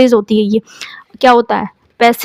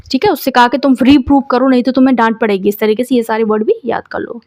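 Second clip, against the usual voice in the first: ordinary talk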